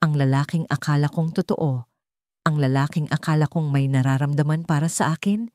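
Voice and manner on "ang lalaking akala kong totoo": ordinary delivery, nothing marked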